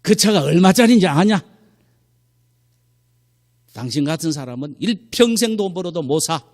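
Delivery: loud, high angry husband; retold anger. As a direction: lash out at the wife, acting it out. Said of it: "화를 내면서". (화내며 쏘아붙이는 남편 흉내)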